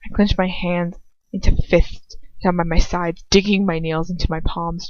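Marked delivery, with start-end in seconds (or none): none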